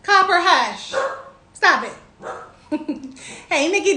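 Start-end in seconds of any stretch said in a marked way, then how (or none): none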